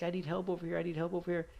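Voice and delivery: high mock yell for help; high-pitched, repeated panicked plea; retold frantic yelling. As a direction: imitate your brother calling urgently, volume held back. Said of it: "he was yelling".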